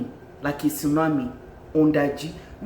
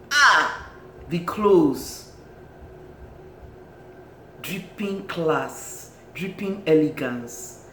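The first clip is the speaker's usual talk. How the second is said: normal talking voice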